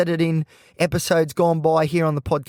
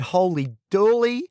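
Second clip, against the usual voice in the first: drawn-out, rising amazement; retold astonished exclamation; amazed; 4 semitones higher than usual. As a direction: stretch it out in wonder, pitch climbing. Retelling his amazed reply.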